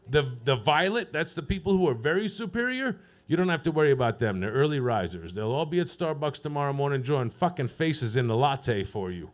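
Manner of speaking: ordinary delivery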